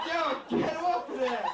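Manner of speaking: high, urgent plea; mock alarm. Urgent warning to father-in-law.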